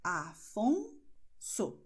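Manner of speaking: Afonso drawn out very slowly; slow demo word. Shown as a demonstration. Teacher models the S after a consonant, very slow. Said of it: "a letra S está justo depois da consoante aqui".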